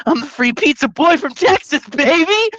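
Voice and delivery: loud, high hype-man brag; hyped brag. Retold or imitated. Telling a fan he is the pizza boy, hyped brag. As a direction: crow it like a proud champion. Bragging quote at high pitch.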